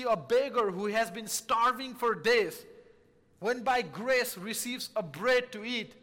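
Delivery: ordinary talk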